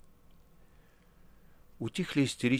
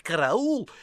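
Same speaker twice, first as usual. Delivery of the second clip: demo cry for help; 6 semitones higher than usual. A narrator's reading. Precentor demonstrating how to shout 'Караул!'. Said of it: "следует скричать".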